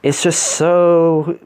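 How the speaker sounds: slow, drawn-out mock gushing; exaggerated sarcastic rapture. Real, not acted. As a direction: stretch SO and AMAZING out with fake delight. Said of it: "Yeah, I'm being sarcastic".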